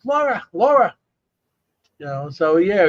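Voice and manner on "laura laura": high squawky call of Laura; parrot calling a name. Retold or imitated. Man imitating his parrot calling his wife, high squawk. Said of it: "He says".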